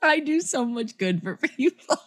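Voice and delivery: high, smug, laughing; smug self-praise. Acted. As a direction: pat yourself on the back.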